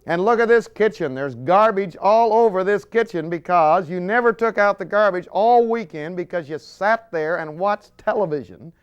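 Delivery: wife nagging about football, loud scold. Retold or imitated. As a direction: scold him about the mess. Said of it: "turns around and says".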